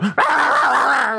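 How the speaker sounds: high, yappy imitated barks; mock dog barking. Dog joins the family fight, high imitated barks. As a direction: bark along with the family chaos. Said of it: "the dog starts going".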